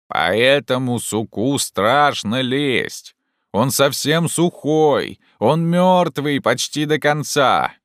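slow, high, stretched-out complaint; calling down. Acted. Jupiter balks at dead branch, calling down. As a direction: holler the warning from up high. Climber calls down his fear.

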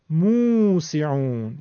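موسعون very slow, first "muu" drawn right out; long waw. Shown as a demonstration. Teacher showing the waw, long "muu" in موسعون. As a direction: hold the "oo" opening موسعون. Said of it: "اینجا واو مدیه".

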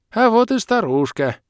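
lightly voiced old man spotting her; old man grunting out. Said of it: "хрюкнул дед".

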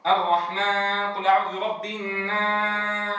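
slow الرحمن with the alif pushed through the nose; nasal twang on the long alif. Shown as a demonstration. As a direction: sing قل أعوذ برب الناس nasally. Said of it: "إشراب الحروف بغنة، فيقول".

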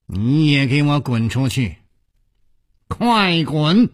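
harsh command to get out; scolding shout. A narrator's reading. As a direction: order him out sharply, building in pitch. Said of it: "斥喝道".

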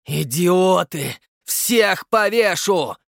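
slow, high, loud bellow; roaring. Acted. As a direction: tear your throat yelling. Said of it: "срывая глотку, рявкнул Черчесов". (орёт, срывая глотку)

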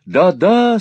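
high, loud, fervent agreement; ardent cry. A narrator's reading. Smike cries agreement with Newman's praise, ardently. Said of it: "с ещё большим жаром вскричал Смайк".

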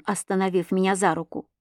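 ordinary delivery, nothing marked

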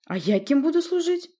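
high, loud indignant demand. A narrator's reading. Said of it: "потребовал Зарвик".

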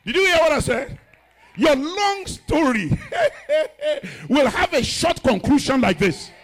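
loud, high, slowed and laughing; preaching at full force. Acted. Pastor promising the congregation a short conclusion. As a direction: drive the promise home with a laugh.

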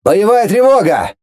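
loud ship-wide roar; roaring alarm. A narrator's reading. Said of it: "по кораблю проревело".

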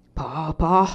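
put-on scornful old man's scoff; contempt. A narrator's reading. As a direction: spit it out with disdain. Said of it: "disse il vecchio con disprezzo". (con disprezzo)

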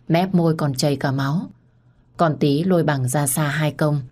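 usual speaking voice

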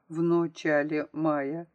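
slow "начале" said as "ночале", clear o; misspelling read out. A narrator's reading. Narrator recalls pupil's misspelt words left on board, slow "ночале". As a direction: draw out the wrong o in "начале": "ночале".

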